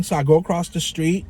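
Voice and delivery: usual speaking voice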